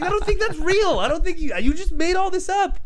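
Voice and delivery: high, loud hammed-up objection; incredulous mock protest. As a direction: protest in comic outrage, voice shooting up. Replays own disbelieving protest.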